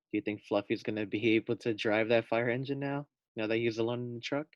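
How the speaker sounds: usual speaking voice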